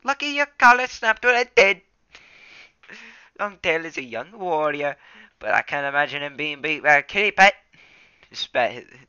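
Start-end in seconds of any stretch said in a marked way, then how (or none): 0.0-7.5 much higher, louder sneering tom cat; scornful Darkstripe. Acted. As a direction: spit out the insult with contempt. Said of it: "spat the word kitty pet scornfully".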